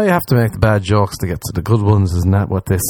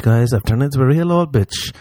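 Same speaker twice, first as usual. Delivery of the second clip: usual speaking voice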